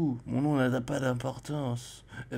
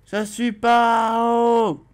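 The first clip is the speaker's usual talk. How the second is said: high, loud, slow yell with "pas" drawn out; hammed-up repeated protest; mock shout; 8 semitones higher, 7 dB louder, slower than usual.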